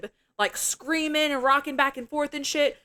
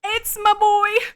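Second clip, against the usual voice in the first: excited fan cheer; 11 semitones higher, 7 dB louder than usual. Real, not acted. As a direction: shout it like a thrilled fan. Fangirling over Tony Todd.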